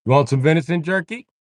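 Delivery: high, loud country hustler offering jerky; hillbilly vendor. Acted. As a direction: hawk it like a backwoods salesman.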